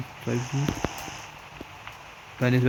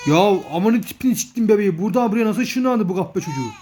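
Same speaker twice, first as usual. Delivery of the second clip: high, fast, half-crying exasperation; fed-up outburst; 7 semitones higher, 3 dB louder than usual. Real, not acted. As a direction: whine it out like you're at the end of your rope. Bebek yine kaçmış, çaresiz isyan. (yarı ağlamaklı, bıkkın isyan)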